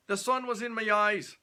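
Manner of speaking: loud, high Scottish protest; Scottish excuse. Acted. Red-faced Scot excusing his misses, loud Scottish accent.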